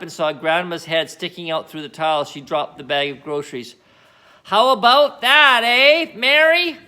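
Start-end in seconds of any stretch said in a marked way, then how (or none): 4.4-6.8 high, loud, slow shout from the rooftop; Grandma shouting. Acted. Grandma shouting down from the roof to Mary, loud and slow. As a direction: bellow it down, thrilled. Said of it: "Grandma shouted".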